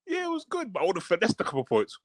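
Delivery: tossed-off mock Rock answer; imitating The Rock. Rock's reply on the show.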